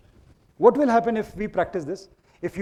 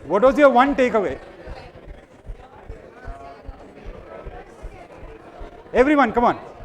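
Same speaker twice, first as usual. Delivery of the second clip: raised, high voice over the noise; calling out to crowd; 6 semitones higher, 5 dB louder than usual. Real, not acted. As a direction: shout instructions across the room.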